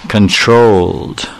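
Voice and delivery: slow, stretched-out word; controlled. Shown as a demonstration. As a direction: say it with measured restraint. Illustrating the controlled city manner.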